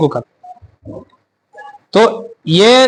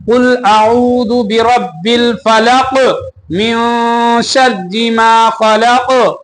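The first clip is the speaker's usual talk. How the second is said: very slow recitation, pausing after each phrase; slow and measured; slower than usual. Shown as a demonstration. Teacher showing students the correct way, slow and paused. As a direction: recite word by word, unhurried, with clear stops. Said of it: "ऐसे ठहर ठहर के पढ़ेंगे".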